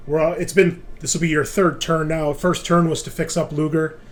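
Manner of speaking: usual speaking voice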